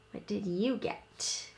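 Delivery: usual speaking voice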